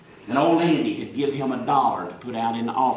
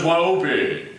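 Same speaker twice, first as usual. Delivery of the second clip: the way he talks; 2 semitones higher, slower than usual. Retold or imitated. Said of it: "That's the way he talks".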